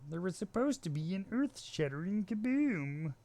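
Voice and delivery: high, bright mock Martian line; lightly voiced Looney Tunes Martian; cartoon Martian. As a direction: quote the cartoon alien with glee. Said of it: "trying to mimic the Martian from the Looney Tunes".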